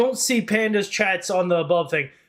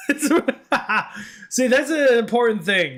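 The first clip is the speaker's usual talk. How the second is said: laughing; 7 semitones higher than usual. Real, not acted. Laughing at herself.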